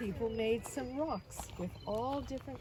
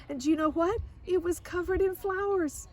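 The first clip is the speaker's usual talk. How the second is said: higher and louder, bursting with news; excited child; 10 semitones higher, 6 dB louder than usual. A narrator's reading. Girl writing home, thrilled by the flower-covered cake.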